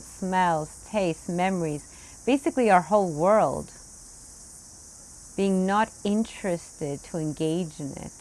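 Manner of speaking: ordinary talk, nothing marked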